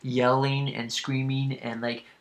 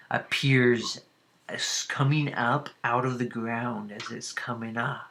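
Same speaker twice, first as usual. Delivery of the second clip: ordinary talk, nothing marked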